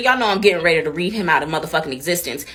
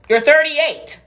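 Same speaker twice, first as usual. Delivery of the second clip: thirty-eight hit even harder and higher; exasperated repeat, louder; repeated with more punch; 6 semitones higher, 6 dB louder, much slower than usual. Real, not acted.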